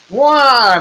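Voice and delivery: sudden high "Wah!" cry, loud; magic cry. Acted. Dwarf milkman crying out to move the stone, high and loud.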